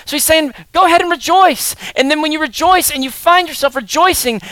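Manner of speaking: loud, high, ringing exhortation; full-force preaching. Acted. Pastor urging his congregation to rejoice, loud and fervent. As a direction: preach it out to the room.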